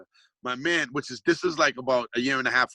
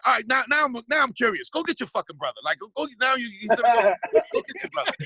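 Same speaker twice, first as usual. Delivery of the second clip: higher, pumped-up egging on; eager dare; 9 semitones higher than usual. Retold or imitated. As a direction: goad him like you can't wait. Daring the guy to fetch brother.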